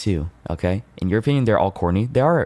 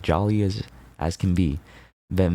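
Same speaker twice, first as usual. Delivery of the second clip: normal talking voice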